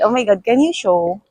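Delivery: normal talking voice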